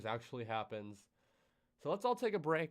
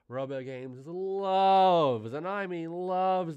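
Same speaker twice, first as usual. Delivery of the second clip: loves stretched slow and loud; stress on loves; 5 semitones higher, 10 dB louder, much slower than usual. Real, not acted. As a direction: lean hard on loves. Emphasizing loves.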